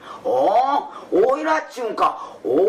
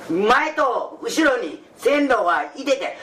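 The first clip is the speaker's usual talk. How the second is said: normal talking voice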